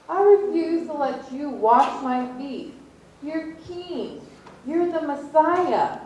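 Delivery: high, pleading refusal; indignant Peter. Retold or imitated. Peter refusing Jesus at his feet, high indignant protest.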